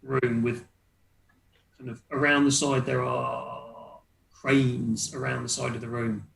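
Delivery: ordinary delivery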